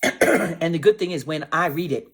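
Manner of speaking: ordinary delivery, nothing marked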